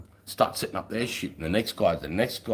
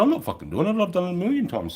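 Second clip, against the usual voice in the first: high, loud angry I'm not doing it; retold furious refusal; 12 semitones higher, 4 dB louder than usual. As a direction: snap back at the officer.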